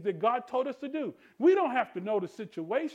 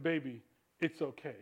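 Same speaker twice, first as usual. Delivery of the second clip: soft, low, hushed reassurance; tender comfort; 7 semitones lower, 8 dB quieter than usual. Retold or imitated. Someone comforting a woman through life, soft and low. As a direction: hush it gently like a comforting hug. Voicing comfort, women understand.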